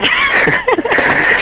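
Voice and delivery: high helpless laugh; real giggle fit that won't stop; high laughter. Real, not acted. Guest apologizing to hosts, laughs uncontrollably. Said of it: "Now that I'm laughing".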